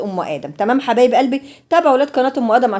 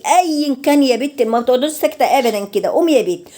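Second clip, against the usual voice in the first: normal talking voice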